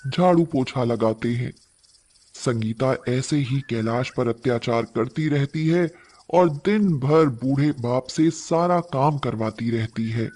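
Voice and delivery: ordinary delivery, nothing marked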